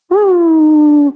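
high wailing ooh; high mock wail; mock sob. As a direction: imitate a mom bursting into tears, high and loud. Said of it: "They're like".

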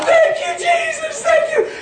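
imitated high squeal of praise; joyful thanks. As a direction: squeak out thanks like an overjoyed woman. Imitates healed woman's repeated thanks.

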